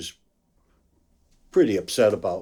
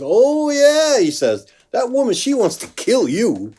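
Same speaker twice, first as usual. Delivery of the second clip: high, amused policeman voice, a little put on; lightly voiced cop; 14 semitones higher, 8 dB louder than usual. Policeman warns the musician about the woman, in a high voice. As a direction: jump up high like the officer did. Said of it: "She goes".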